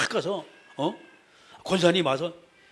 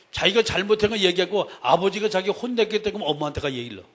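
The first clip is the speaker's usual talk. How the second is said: ordinary delivery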